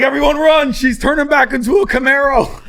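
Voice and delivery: high, loud panicked yell. Retold or imitated. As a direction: scream like people fleeing a monster. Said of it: "it'd be like".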